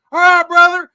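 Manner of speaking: high, loud holler; as Bullfrog. Retold or imitated. Imitating Bullfrog calling goodbye out the window, high and loud. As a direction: bellow the farewell as Bullfrog. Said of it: "I'm like".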